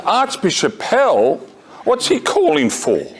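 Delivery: high-pitched mock disbelief, name drawn out; startled question. Teacher startled by who phoned, retold surprised calling back.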